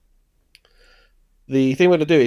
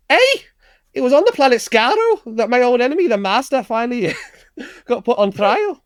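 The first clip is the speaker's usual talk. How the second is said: very high, broad comic Scouse; over-the-top Scouse accent; 10 semitones higher than usual. Acted. Host performs the film's opening monologue, exaggerated Scouse accent. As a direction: go all in on Scouse, pitched way up. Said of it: "not gonna do a very slight Scouse accent".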